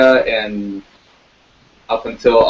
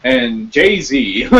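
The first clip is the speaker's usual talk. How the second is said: higher, pompous mock pundit announcing his crusade; mock Bill O'Reilly; 6 semitones higher than usual. Mocking a TV host's rap crusade, pompous pundit voice. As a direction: ham up a self-righteous TV host. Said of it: "he, he says".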